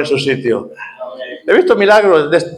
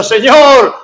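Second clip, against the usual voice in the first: high, pleading call that climbs; blind man crying out; 9 semitones higher, 4 dB louder than usual. Retold or imitated. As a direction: beg for mercy at the top of the voice. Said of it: "él seguía gritando".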